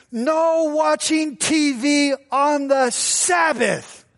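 high, slow, prissy church-lady rule; prim scold. Retold or imitated. Date forbids TV on Sabbath, high prim slow voice. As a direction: lay down the law primly and slowly. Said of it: "She was so upset".

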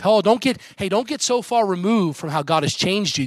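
normal talking voice

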